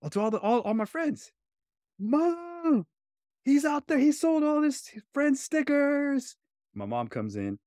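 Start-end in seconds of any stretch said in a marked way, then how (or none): 0.0-1.3 lightly voiced small boy, much higher; a hint of an innocent young child, pitched up high; little kid self. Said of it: "She's like". 2.0-6.4 much higher, slower mock sister voice; tattletale big sister. Sister telling on him to mom.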